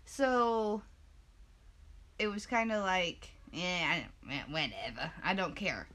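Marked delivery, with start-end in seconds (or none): 3.5-5.9 low, offhand dismissal; indifferent shrug. Retold or imitated. Acts out own indifference.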